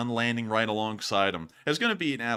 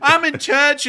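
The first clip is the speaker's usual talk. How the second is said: much higher, louder and slow, a phony politician voice; mocking politician; 14 semitones higher, 11 dB louder, slower than usual. Streamer mocking out-of-touch politicians, hammed-up high phony voice. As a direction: lampoon a pandering politician. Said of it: "politicians out there who go".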